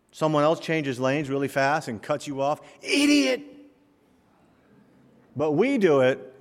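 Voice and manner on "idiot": furious shout. Acted. Driver yelling at someone who cut him off, furiously. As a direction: yell it high and loud at the other driver.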